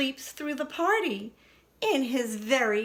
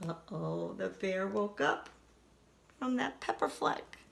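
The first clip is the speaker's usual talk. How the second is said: soft, low storytelling hush; hushed suspense; 4 semitones lower, 8 dB quieter than usual. A narrator's reading. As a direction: whisper the danger coming.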